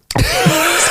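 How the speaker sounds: a sudden high laugh. Real, not acted. Host laughing at the cohost's joke, high.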